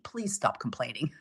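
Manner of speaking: soft plea. Shown as a demonstration. Coach pleading with her group, soft and low. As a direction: drop way down and plead softly.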